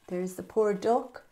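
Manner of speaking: ordinary delivery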